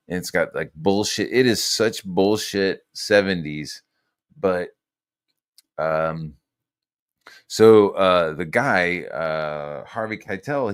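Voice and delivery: ordinary talk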